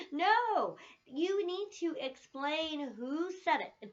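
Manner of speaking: a teacher insisting her class explain who said a quote; firm correction. Shown as a demonstration. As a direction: lay down the rule slowly, no arguing.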